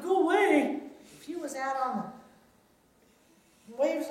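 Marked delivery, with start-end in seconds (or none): 0.0-0.8 high, loud storybook shout. A narrator's reading. Disciples to the figure on the waves, shouting. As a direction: shout it off in alarm. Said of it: "and they shouted".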